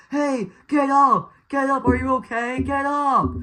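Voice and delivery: high, loud acted yell; imitated intense yelling. Guy rousing a bloodied crash survivor, imitated yelling. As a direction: yell it urgently, like a film character. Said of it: "he's, like, yelling it".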